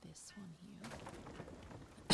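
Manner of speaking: fast hushed whisper; whispering while deleting a piece. Real, not acted. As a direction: rush it out under your breath. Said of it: "I don't know why I'm whispering".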